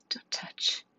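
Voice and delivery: weak whispered plea, almost no voice; pained whisper. Acted. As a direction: breathe it out in pain. Child in pain pushing mother away.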